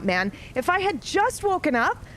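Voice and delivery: ordinary talk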